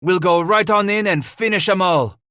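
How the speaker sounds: high, loud, determined threat; vowing. A narrator's reading. Henri promises to storm the cabin, vowing loudly. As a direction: vow it forcefully to your men. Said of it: "Henri vowed".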